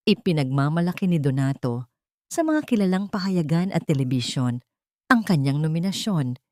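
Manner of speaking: plain reading voice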